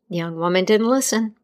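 usual speaking voice

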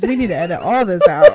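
fast, loud laughing words. Real, not acted. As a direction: blurt it out through laughter. Laughing while crying.

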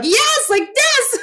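very high, drawn-out delighted exclamation; squealing "that's exactly it" enthusiasm; excited agreement. Retold or imitated. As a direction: burst out agreeing, pitch soaring, laughing. Acting out her delighted agreement.

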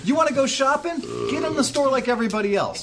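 high, sneering rant at a cop; sarcastic rant. Retold or imitated. Ex-cop scolding an officer who skips the line, high sneer.

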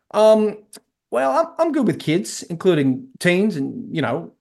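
hesitant, badly faked Texan; heavy American South accent. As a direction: fumble the answer in a bad Texan accent. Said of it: "Dean, in a heavy American South accent".

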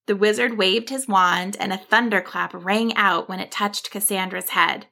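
plain reading voice

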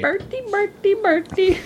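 high sing-song call. Pretend. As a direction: call the name high and bright. Calling out to friend.

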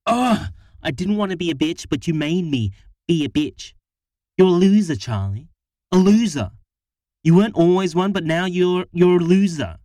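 loud, high tirade, speeding up midway; wife ranting at her partner, loud and furious; angry rant. A narrator's reading. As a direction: unload everything on him at once. Harriet's angry rant at Charlie.

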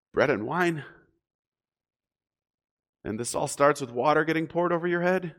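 incredulous questions, each lifting at the end; sceptical questioning. Acted. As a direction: voice a doubter querying each item. Voices a doubter's sceptical questions.

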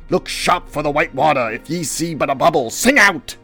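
loud, high lookout orders; Ahab. Acted. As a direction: call out orders, rising to a shout. Ahab calling orders to crew.

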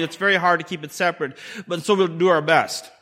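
usual speaking voice

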